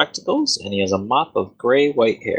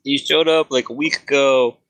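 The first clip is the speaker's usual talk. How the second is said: higher, weary wizard rant; an overworked wizard sick of chicken questions; fed-up wizard; 5 semitones higher than usual. Acted. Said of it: "No, he's like".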